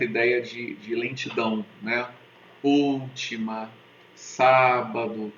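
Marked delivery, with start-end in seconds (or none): 2.6-5.3 "Última" and "sábado" stretched slowly, the first syllable leaned on; dragged-out proparoxytones. Shown as a demonstration. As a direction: let "Última", "sábado" drag with the stress up front. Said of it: "ela se arrasta".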